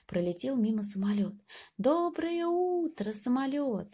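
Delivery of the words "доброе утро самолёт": high, slow call to the plane; mild shout to the plane. Acted. Mouse calling to the airplane, high and slow. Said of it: "закричал мышонок".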